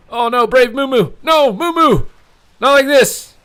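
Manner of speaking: high, loud despairing wail; desperate shout of dismay; anguished cry. Real, not acted. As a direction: plead as your hero falls. Favourite player about to die.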